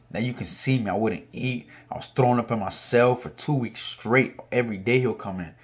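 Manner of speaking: ordinary delivery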